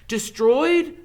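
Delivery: high, loud stunned question; shocked Harry. Acted. Harry hears the stone is gone, high and stunned. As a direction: gasp the word out in disbelief.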